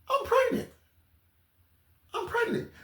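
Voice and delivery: very high put-on squeal; very high falsetto lie; mock pregnant plea. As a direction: squeak it out to dodge jail. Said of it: "just lie".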